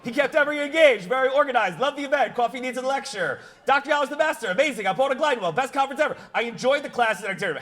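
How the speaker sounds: high, fast rattle of compliments; skimmed praise. Retold or imitated. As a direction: race through the praise without stopping. Racing through praise in a review.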